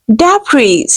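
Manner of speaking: debris said "dab-ree" with a hard wrong vowel. Shown as a demonstration. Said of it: "this is not debris".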